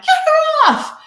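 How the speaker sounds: high, loud retold panic cry; panicked. Actor trapped in the prosthetic cast, panicked cry. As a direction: yell it in a panic, pitch shooting way up. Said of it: "'cause you panic and you're like".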